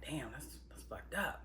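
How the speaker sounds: hushed, breathy aside under the breath; shocked whisper. Retold or imitated. Viewer whispering their shock at a scene. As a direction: whisper it like you can't believe it. Narrator voices own shocked reaction.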